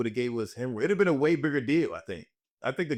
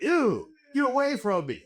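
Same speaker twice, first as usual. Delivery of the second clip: disgusted recoil; 11 semitones higher, slower than usual. Pretend.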